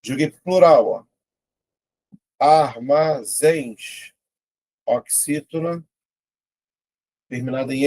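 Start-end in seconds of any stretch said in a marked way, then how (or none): 2.4-4.2 ARMAZÉNS very slow, ar-ma-zéns; ARMAZÉNS in three drawn-out beats; syllable by syllable. Shown as a demonstration. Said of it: "vou separar".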